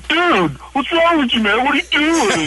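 very high, put-on protest; high-pitched mocking imitation of indignation; mock outraged quote. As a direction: squeal it like an outraged victim, mockingly. Mimics moviegoers' outraged response.

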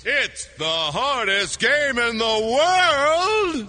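slow, booming announcer catchphrase; drawn-out game show announcer. Acted.

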